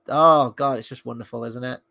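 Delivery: normal talking voice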